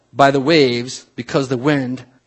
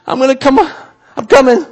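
high, loud, nervous straining; clinging Peter; 10 semitones higher, 7 dB louder than usual. Acted. Peter lowering himself from the boat, strained. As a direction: call it out high while hanging on.

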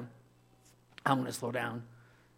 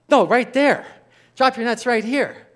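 loud, higher command as Jesus; calling out; 8 semitones higher, 10 dB louder than usual. Acted. Jesus calling to fishermen where to drop nets, loud. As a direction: point and call it out across the water.

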